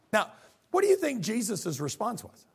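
ordinary talk, nothing marked

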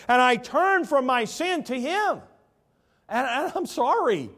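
loud, high preacher's cry; fervent revival preaching. Acted. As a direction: preach it out with full force. Preaching at full force.

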